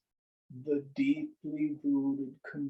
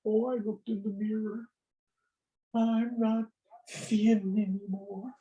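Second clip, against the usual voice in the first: high-pitched play-acted lament; hammed-up self-pity; mock dismay; 8 semitones higher than usual. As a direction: bemoan it in a raised, put-on voice.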